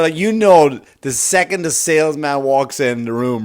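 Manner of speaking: ordinary talk, nothing marked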